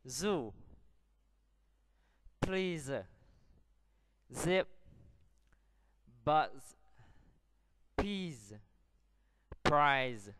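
one word at a time, voiced Z in "Zoo", "Zip", "Buzz"; modelling the Z sound. Shown as a demonstration. Teacher has pupils repeat after her, clear voiced Z in "Please, Peas, Prize". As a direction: say each for copying, buzzing Z ending "Peas", "Prize". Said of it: "Now listen and repeat after me".

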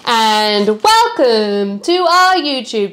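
slow, drawn-out welcome; YouTuber intro. Acted. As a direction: announce it like a vlog intro. Doing the channel intro.

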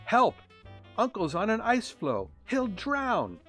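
high, loud cry for help; panicked cry. A narrator's reading. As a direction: cry out in alarm. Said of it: "Rudolph ran to Santa's workshop and cried".

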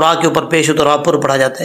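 ordinary talk, nothing marked

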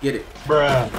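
ordinary talk

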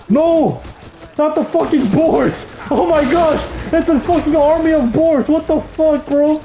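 panicked yelling. Real, not acted. Army of boars charging.

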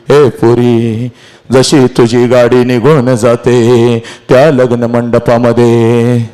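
low, slow lamenting storytelling; sorrowful, heavy delivery; mournful oration. Acted. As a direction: draw each phrase out toward tears.

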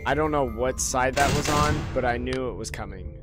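ordinary talk

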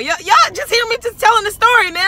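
high, loud laughing voice; laughing at her own story. Real, not acted. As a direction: laugh through the words.

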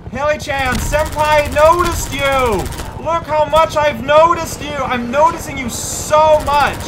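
high, slow, gushing fangirl squeal; excited anime fan. Pretend. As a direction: coo at it like a lovestruck anime fan. Gushing at the helicopter, senpai talk.

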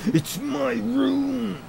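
very high, drawn-out whiny voice; whiny mock. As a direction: stretch it out like a spoiled kid. Mocking the server owner.

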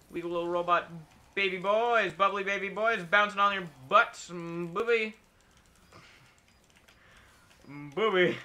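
put-on 1 taunting baby talk.

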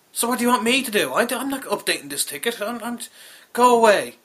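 high, loud indignant protest; exasperated outburst. Retold or imitated. Caller refusing to update the ticket, exasperated and loud. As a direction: send them packing, loud and high. Acted quarrel with support agent.

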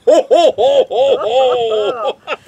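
high, loud string of ho-ho-hos; hammed-up, pumped-out jolly laugh; Santa laugh. Husband after his own pun, Santa laugh.

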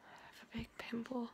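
quick unvoiced whisper. Real, not acted. Vlogger confides to the camera in a quick whisper. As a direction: whisper it like a secret.

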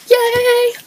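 high squeal of joy; excited yay. Real, not acted. Girl cheering about her birthday tomorrow, a high squeal. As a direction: squeal it out, thrilled.

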